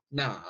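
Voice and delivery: "Na" said low and dipping; third tone na. Shown as a demonstration. Teacher modelling the questioning tone of "Na". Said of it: "Nada yang ketiga adalah nada yang sesuai untuk bertanya".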